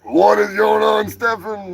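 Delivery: calling out. Real, not acted. Calls out to Stefan.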